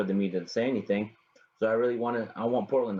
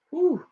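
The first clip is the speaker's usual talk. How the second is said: whew; 7 dB louder than usual. Real, not acted. Reacting to Lillard's scoring.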